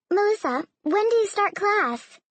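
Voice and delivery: high, brisk change of subject; interrupting. A narrator's reading. Mother cutting off dinner talk to ask her daughter, interrupting. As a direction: cut in with a lifted voice. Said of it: "her mother interrupted".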